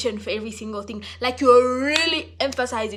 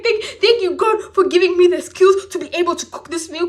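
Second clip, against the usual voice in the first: giddy thanks; 6 semitones higher, 7 dB louder than usual. Acted. Giddy over-the-top thanks after laughing.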